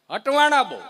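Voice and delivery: catchphrase belted out high and loud; punchline shout. Real, not acted. Comedian belts the catchphrase at the crowd, loud and high. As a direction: hammer home the punchline to the crowd.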